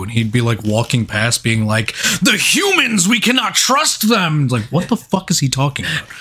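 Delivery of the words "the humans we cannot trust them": high, wandering mock senile robot leader; senile Optimus Prime. Mocking the old voice actor, high senile leader voice. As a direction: play him lost and doddering. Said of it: "he sounded senile".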